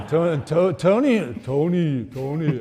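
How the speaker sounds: laughing, loud mock French calling; hammy French-accented name; fake French accent. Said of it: "It's a bad impression".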